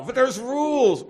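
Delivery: loud, high, slow proclamation; booming emphasis. Real, not acted. Preacher to seminarians, booming that there are rules. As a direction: thunder out the point.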